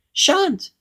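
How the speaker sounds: very high, cheeky refusal; grinning goblin. A narrator's reading. Goblin defying Mister Uppity, grinning high voice. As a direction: refuse in a squeak with a grin. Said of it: "grinned the goblin".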